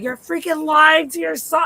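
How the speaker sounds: high, outraged yell; retold scream. Viewer screaming at the mother on screen, outraged. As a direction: scream the accusation as you replay losing it. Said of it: "I was screaming at her".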